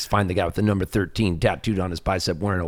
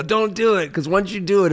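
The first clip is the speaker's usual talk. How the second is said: higher, teasing mock warning; deadpan advice; 11 semitones higher, 3 dB louder than usual. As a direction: tease them with a fake warning. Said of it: "I go".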